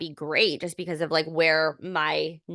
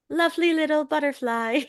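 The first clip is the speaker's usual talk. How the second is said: high, dainty mocking lilt; mock sweetness; 9 semitones higher than usual. Speaker dismissing the glamorized pregnancy image, mock sweetness. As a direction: coo it high, as if pregnancy were precious.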